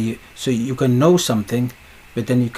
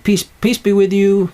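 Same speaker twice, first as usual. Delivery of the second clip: high, loud, held call; proclaimed greeting; 8 semitones higher, 6 dB louder than usual. Retold or imitated. Voicing Jesus greeting those in the locked room, high loud call. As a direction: call the blessing out over the room. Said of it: "They said".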